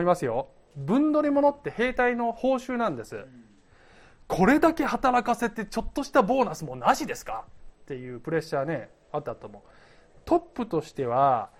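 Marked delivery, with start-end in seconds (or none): 4.3-7.4 mock complaint. Acts soldiers pressuring their king.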